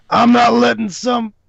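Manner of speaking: high-pitched hammed-up protest; defiant cow character. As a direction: holler it defiantly, pitched well up. Game master voices charging cow.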